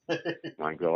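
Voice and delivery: ordinary talk, nothing marked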